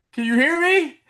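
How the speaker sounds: mock shout into the distance; calling out. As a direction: call out to someone who may not hear. Calling out to make contact.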